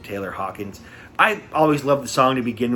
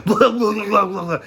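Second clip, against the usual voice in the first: high, sputtering mock gibberish; imitated choking garble; 8 semitones higher, 4 dB louder than usual. Retelling the singer choking on his hair mid-song, mock sputtering. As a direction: sputter as if hair is stuck in your mouth. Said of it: "got sucked into his mouth".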